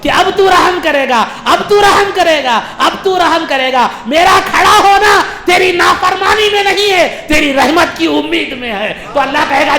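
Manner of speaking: high, loud insistent plea; full-force preaching. Acted. Sinner waits before Allah hoping for mercy, impassioned preaching. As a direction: build the plea with driving repetition.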